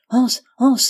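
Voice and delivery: called out high and loud, fast; cri pressé. A narrator's reading. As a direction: shout his name up the stairs in a hurry. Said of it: "cria très vite". (appel crié, aigu et fort)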